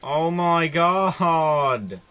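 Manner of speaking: long, loud, drawn-out cry; exasperated yell. Real, not acted. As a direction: wail it very slowly and loudly. Still being chased in game.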